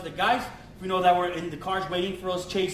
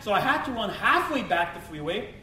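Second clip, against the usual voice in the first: usual speaking voice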